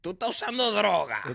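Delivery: a light mock of the owner; mock accusing worker. Dominican owner accusing the customer, high teasing. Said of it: "pero este hombre".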